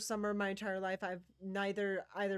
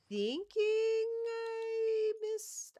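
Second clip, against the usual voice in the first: puzzled drawl; 7 dB louder, much slower than usual. Real, not acted. Stretched puzzled high drawl.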